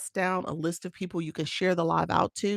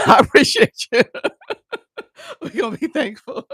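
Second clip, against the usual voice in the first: laughing; 9 semitones higher, 12 dB louder than usual. Real, not acted. Laughs while thanking viewers.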